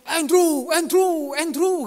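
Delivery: high loud cheer of his name; cheering call. Retold or imitated. Old friends cheering him on. (teman-teman tua menyemangati Andrew, sorak keras)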